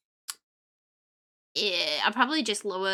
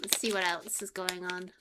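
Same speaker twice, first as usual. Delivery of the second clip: ordinary talk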